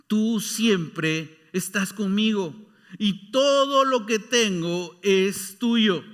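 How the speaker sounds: very slow and deliberate; slow fatherly words. Acted. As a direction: let each word of the father land. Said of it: "le dijo su padre". (el padre le habla muy despacio)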